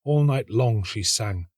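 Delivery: usual speaking voice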